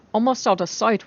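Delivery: ordinary delivery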